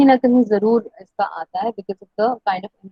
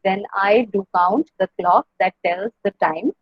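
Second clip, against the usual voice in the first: slow, separated beats, stressing I, count, clock, tells, time; iambic syllable count; much slower than usual. Shown as a demonstration. Teacher counts ten syllables for students, slow iambic stress on "When I do count". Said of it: "second syllable पे emphasis है".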